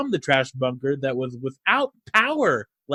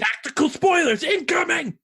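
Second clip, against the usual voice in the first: high loud military callout; tactical announcer; 5 dB louder than usual. Pretend. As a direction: bark it like a soldier on the radio.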